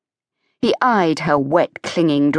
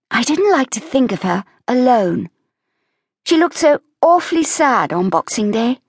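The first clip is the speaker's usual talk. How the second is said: put-on higher young woman's voice, mild sorrow; Evie, gently sad; 7 semitones higher than usual. Evie speaks of Mary.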